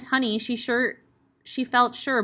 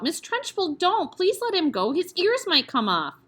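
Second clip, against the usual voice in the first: high and urgent, pleading; anxious cry; 10 semitones higher, 3 dB louder than usual. Acted. Teacher cries out to the headmistress to stop. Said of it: "Miss Honey cried out".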